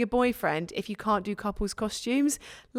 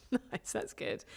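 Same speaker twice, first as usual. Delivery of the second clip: breathless laugh; 11 dB quieter, much slower than usual. Real, not acted. Laughing at his ghost sound.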